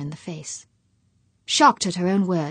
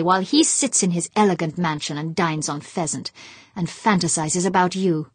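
loud, seething rant, louder than his own talk; raging hatred; 7 dB louder than usual. Acted. Husband pouring out his hatred of Humphreys to his wife, loud and furious. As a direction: spit out every I hate.

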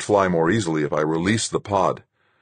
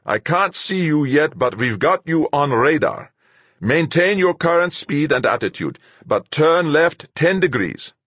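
put-on high, loud, accented radio orders; Fuchs, urgent commander; 10 semitones higher, 5 dB louder than usual. A narrator's reading. Fuchs guiding the pilot in by radar, urgent commander. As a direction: give insistent flight directions.

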